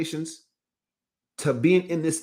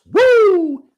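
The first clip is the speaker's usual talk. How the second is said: loud whoop; 11 dB louder than usual. Real, not acted. Exclaims after the quote.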